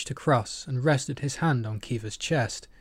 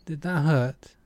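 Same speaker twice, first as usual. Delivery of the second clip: playful; 4 semitones higher than usual. A narrator's reading. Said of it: "Aki asked playfully".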